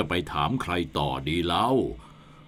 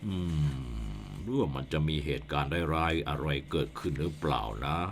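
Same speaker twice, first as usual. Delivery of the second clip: softer, slow, lower musing; worried murmur; 11 semitones lower, 11 dB quieter, slower than usual. Acted. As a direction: wonder aloud under your breath. Headman worries aloud.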